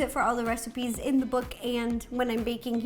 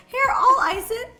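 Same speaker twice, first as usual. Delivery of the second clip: higher, louder mimicked offer; imitating sister; 8 semitones higher, 6 dB louder than usual. Baker retells her sister offering to ice it, mimicking. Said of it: "because she's like".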